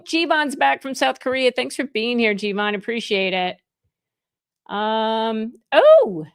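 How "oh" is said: sudden high, loud oh; surprised delighted exclamation. Real, not acted. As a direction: caught off guard, delighted. Surprised by viewer in Australia.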